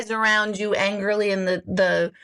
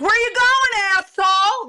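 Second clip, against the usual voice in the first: much higher, louder angry yell; road rage yell; 11 semitones higher, 5 dB louder than usual. Retold or imitated. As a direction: shout at the driver who cut you off. Yelling at a driver in car.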